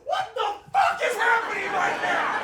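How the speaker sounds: high, slow incredulous shout; panicked dad. Retold or imitated. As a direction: cry out in comic panic, stretched out. Dad reacts to pretend birth.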